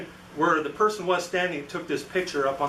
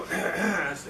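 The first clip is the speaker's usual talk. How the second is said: small polite throat clear; demonstrated throat clear; 4 dB quieter than usual. Retold or imitated. Visitor announcing himself to a man at a desk, polite throat clear. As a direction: clear the throat to get attention. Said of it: "made some noise so I wouldn't startle him".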